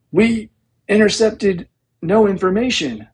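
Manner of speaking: high, strained gurgle of a man being strangled; choked gurgle. A narrator's reading. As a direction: squeeze the words out, barely able to breathe. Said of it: "The dangling officer gurgled".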